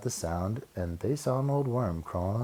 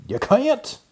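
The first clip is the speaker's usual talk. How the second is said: much higher, loud snap; snapped; 9 dB louder than usual. Acted. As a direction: bite back hard. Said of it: "snapped the rabbit".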